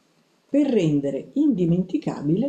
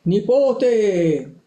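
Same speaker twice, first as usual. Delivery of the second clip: loud, drawn-out bellow of "nipote"; grandfather's thundering call; booming yell; 3 semitones higher, 5 dB louder than usual. A narrator's reading. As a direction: burst in roaring for your grandson. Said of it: "un urlo mi fece arricciare".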